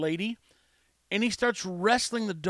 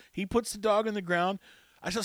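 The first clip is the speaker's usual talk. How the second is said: ordinary delivery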